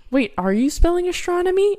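retold disbelief. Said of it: "I was like".

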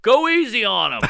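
loud, high imitated heckle; calling out. Audience member defending the man, loud mock call. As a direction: call it from the back of the room.

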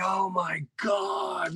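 slow, high shout of disbelief; stunned outcry. Retold or imitated. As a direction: blurt it out in shock. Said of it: "I'm like".

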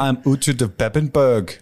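slow, heavy Schwarzenegger accent; an Uhtred that slides into Schwarzenegger. Shown as a demonstration. For the podcast crew, an Uhtred line in a Schwarzenegger accent. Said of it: "there's not supposed to be any Schwarzenegger in it".

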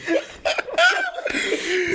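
high bursting laugh. Real, not acted. Telling the friend about the Poly campus, high laugh. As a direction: laugh at your own story.

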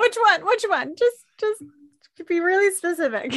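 high, loud, excited insistence; eager demand. Retold or imitated. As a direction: push for the answer, laughing. Joins, acting the eager demand.

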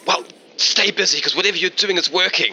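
high, eager radio voice; Trevor. Acted. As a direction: cheer the team on over the radio. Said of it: "you hear over the radio from Trevor".

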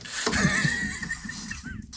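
high-pitched burst of laughter; real laugh at his own bit. Real, not acted.

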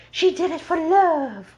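high, drawn-out mock swooning; hammed-up lovesick protest; mocking imitation. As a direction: whine his excuse slowly, high-pitched and derisive. Mocking Gavin's excuse for Alice.

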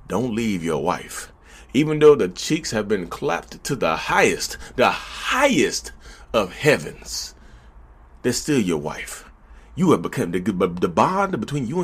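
low, soft, slow solemn pastor's counsel; mock pastor counsel. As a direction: counsel gently like a pastor with a straight face.

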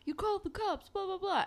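very high, put-on outrage; mock accusation. Retold gunman's accusation.

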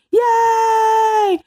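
high cheering shout; joyful yay shout. Real, not acted. Celebrating, wants cheering.

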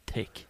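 "thick" said with a hard t, like "tick"; blunt Munster "thick", th turned to t; Munster pronunciation. Shown as a demonstration. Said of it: "I have a typical Munster accent".

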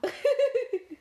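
loud, high burst of laughter; real laugh. Real, not acted. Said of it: "How do you think he really laughed?".

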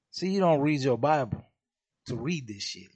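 ordinary delivery

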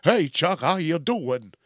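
loud, over-cheery goofy greeting; chirpy, goofy and too happy; goofy happy robot. Acted. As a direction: play an irritatingly goofy helper robot. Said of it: "kinda goofy and happy".

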